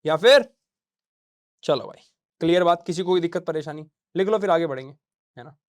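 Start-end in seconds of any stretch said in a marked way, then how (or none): none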